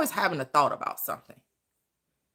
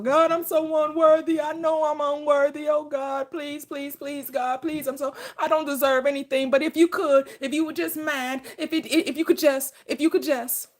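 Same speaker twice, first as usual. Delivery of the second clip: higher, pleading, whiny mock prayer; mock groveling prayer; 5 semitones higher than usual. Preacher mocking people who grovel to God, pleading. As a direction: beg God like a desperate sinner, hammed up. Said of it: "crying out to him".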